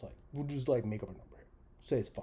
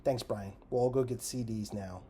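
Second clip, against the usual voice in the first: louder, mock-cheery thanks; loud sarcasm; 8 dB louder than usual. Real, not acted. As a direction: fake gratitude, dripping sarcasm.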